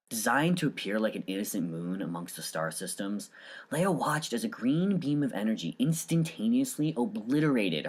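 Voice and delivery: soft, hushed storyteller reading; story narration. A narrator's reading. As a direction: draw the listener into the tale quietly.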